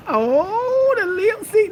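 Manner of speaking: high, loud excitement; excited cry. Real, not acted. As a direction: call it out in delight. Shrieking reaction.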